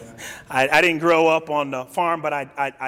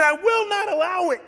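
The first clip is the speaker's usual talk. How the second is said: high, insistent refusal; Peter's defiance; 13 semitones higher than usual. Acted. Peter objecting to Jesus' foretold death, high defiant refusal. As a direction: hold it high and firm in protest. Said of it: "And Peter said".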